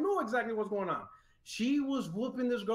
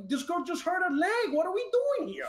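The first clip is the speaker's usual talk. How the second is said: disbelief; 12 semitones higher than usual. Retold or imitated. Stunned husband quoting himself.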